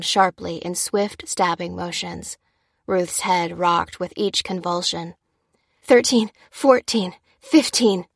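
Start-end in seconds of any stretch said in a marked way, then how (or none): 5.8-8.1 effortful count, slow and raised; laboured, loud counting; panting count. A narrator's reading. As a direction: count aloud while panting from compressions. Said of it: "panted Lucy".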